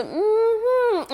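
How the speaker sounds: closing mm-hmm. Shown as a demonstration. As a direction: shut the talk down.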